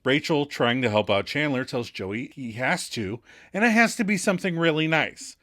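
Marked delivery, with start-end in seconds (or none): none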